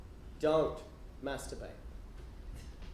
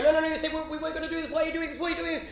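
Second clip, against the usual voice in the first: high-pitched mock protest; alarmed protest; 6 semitones higher, faster than usual. Boy protesting to his brother at church camp, high and alarmed. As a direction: protest in a high, urgent plea.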